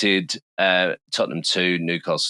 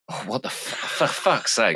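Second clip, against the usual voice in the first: exasperated laugh; 4 semitones higher than usual. Real, not acted. Realises wrong prediction.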